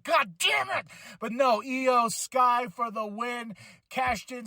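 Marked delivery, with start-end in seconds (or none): 0.0-0.9 frustrated shout. Retold or imitated. Vlogger retelling his anger at Bianca's win, a high frustrated shout. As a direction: shout it as your pick loses. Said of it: "I was like".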